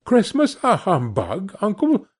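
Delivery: high, rising disbelief; incredulous. A narrator's reading. Nephew questions his uncle's dismissal of Christmas, incredulous. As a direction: question it with pitch climbing at the end.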